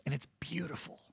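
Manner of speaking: hushed, breathy whisper. Real, not acted. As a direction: whisper it in awe. Awed aside about God's work.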